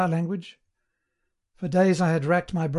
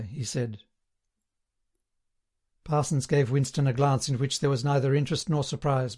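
usual speaking voice

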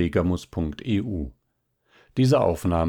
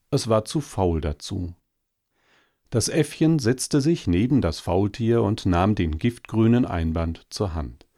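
usual speaking voice

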